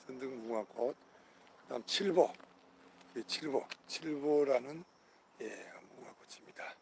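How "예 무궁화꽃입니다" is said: whisper. Real, not acted. Unvoiced aside.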